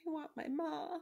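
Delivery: high, small wailing voice; high-pitched pleading; plaintive cry. A narrator's reading. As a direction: cry it out like a child wanting mother. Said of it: "cried Meg".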